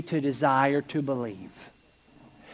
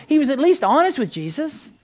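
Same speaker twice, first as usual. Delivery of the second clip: ordinary talk, nothing marked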